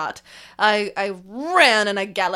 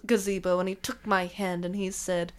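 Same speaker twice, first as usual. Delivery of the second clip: ordinary talk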